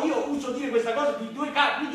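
usual speaking voice

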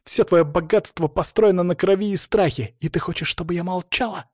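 indignant, pained challenge; hatred and pain. A narrator's reading. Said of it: "смешивались десятилетия ненависти и боли". (с ненавистью и болью)

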